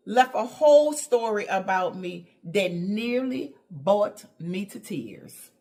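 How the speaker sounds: ordinary delivery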